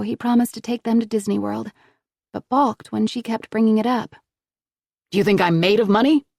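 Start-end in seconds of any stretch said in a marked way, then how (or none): 5.1-6.3 fast, louder irritated snap; fast angry snap; snapped. Acted. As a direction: cut her off sharply. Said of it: "he snapped".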